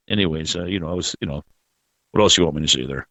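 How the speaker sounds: usual speaking voice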